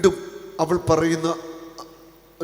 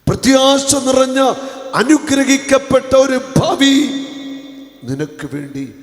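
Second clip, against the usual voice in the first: loud, high blessing proclamation; fervent preaching; 9 semitones higher, 10 dB louder than usual. Acted. Preaching at full force. (ആവേശത്തോടെയുള്ള പ്രസംഗം)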